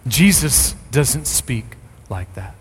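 ordinary talk

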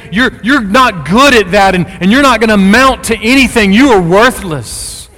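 loud, high tongue-lashing; scolding condemnation. Acted. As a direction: berate them at full volume. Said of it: "Jesus does not talk like that".

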